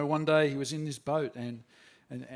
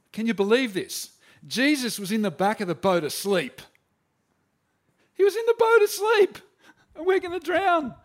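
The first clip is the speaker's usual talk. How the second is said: much higher and louder, frantic alarm; panicked; 7 semitones higher, 8 dB louder than usual. Retold or imitated. Disciple in the sinking boat, frantic panicked cry.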